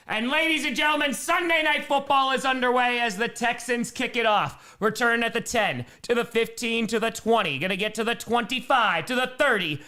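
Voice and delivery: sportscaster calling the return; play-by-play. Acted. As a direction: call the kickoff like an announcer. Play-by-play of the game.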